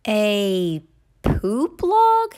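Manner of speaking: slow, drawn-out disbelieving question; puzzled question. A narrator's reading. Child asks Mama, slowly and puzzled. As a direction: repeat it slowly as if you can't believe it.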